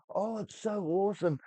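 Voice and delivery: high, mocking gush; high, sarcastic impression of gushing reviewers. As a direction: sneer at the reviewers in a high, silly voice. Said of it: "they looked up".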